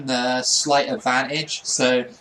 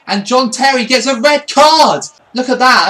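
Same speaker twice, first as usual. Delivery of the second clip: louder, higher commentator burst; excited call; 5 semitones higher, 6 dB louder than usual. Real, not acted. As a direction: call the red card with glee. Rival gets sent off.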